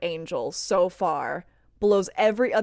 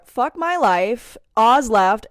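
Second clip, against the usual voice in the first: all caps; 3 semitones higher, 10 dB louder, much slower than usual. Retold or imitated. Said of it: "in all caps".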